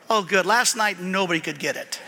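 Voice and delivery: usual speaking voice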